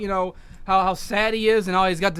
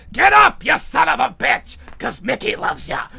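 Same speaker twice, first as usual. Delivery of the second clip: mentor's shout; 2 semitones higher, 5 dB louder than usual. Retold or imitated. Mick's voice urging downed Rocky, loud rousing shout. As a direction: bark him back to his feet. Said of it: "he remembers the voice of his old mentor, Mick".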